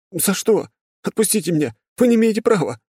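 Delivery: high desperate outcry; frightened protest. Acted. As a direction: cry out in panic. Boy protests being held.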